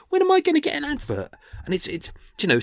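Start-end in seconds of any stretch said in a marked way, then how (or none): 0.1-1.3 high, fast whiny complaint; mock whine.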